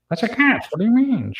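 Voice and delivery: very high squeaky pet voice; cat voice. Pretend. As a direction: pitch way up for the talking cat. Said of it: "Can you do it now".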